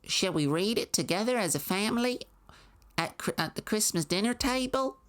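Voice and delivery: high, sugary small-town mother voice; mock Maine mom. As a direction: gush like a proud mom in Maine. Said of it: "So it'd be like".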